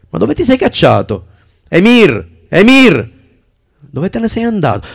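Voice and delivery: high, loud call across the garden. Acted. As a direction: shout for the lost dog. Calling the dog in the garden. (chiamare a gran voce)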